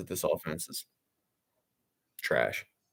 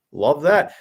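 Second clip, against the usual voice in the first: sarcastic; 5 semitones higher, 7 dB louder than usual. Real, not acted. False start on a punt.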